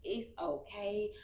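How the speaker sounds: lightly voiced doctor reassuring her; a hint of a kindly doctor; oncologist's reassurance. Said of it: "He was like".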